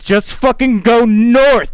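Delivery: loud, slow, drawn-out command; exasperated shouted order. Retold or imitated. Schindler orders lost friend over phone, loud and exasperated. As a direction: push each word out forcefully, fed up.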